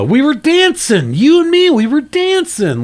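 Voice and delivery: high, lilting mock villain voice; imitating the killer. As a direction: mimic the villain's eerie gloating. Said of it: "he's like".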